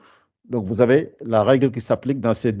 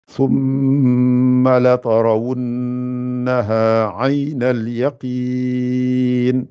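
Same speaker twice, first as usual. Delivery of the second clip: very slow chanted verses; Quranic recitation; much slower than usual. Shown as a demonstration. Teacher reciting surah to the class, slow chant. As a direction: stretch each word in measured chant.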